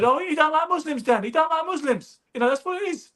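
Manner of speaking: high-pitched mimic of the trainer, sly and offhand; Jim McDonnell voice. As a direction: pitch way up to play Jim fobbing off his fighter. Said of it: "And then Jim said".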